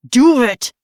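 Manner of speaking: high, loud bellow. Acted. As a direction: bellow it furiously. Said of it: "Sawyer bellowed".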